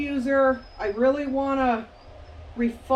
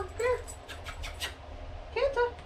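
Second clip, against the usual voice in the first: calling from far away; 9 semitones higher, 8 dB quieter than usual. Real, not acted. Calling the dog over.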